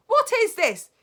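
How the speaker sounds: put-on high, loud bratty boy; puzzled kid demanding answers; boy asking. Bruno asking the witches, high and loud. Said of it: "he said".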